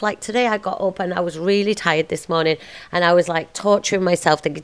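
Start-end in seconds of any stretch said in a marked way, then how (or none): none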